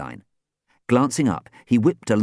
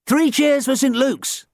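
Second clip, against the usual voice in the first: high, loud rallying call; calling cheers; 5 dB louder than usual. Acted. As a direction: lead the team's cheer. Dan returns the cheers.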